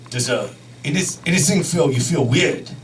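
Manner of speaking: put-on low, halting Japanese-accented doctor; mock Japanese accent, groping for words; imitated Japanese accent. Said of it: "this is how he spoke".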